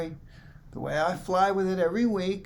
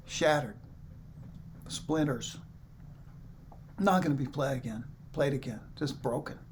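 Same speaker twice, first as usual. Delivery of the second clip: quiet grief; 3 semitones lower, 7 dB quieter than usual. Real, not acted. Mourning the broken guitar.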